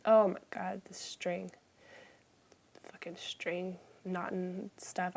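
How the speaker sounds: frustrated whisper. Real, not acted. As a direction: whisper the curse under your breath. Frustrated over tangled string.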